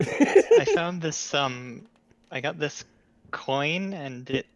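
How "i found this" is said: higher, louder and slow, I found this; barging back in with I found this; loud restart. Acted. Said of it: "it's weird to say it now".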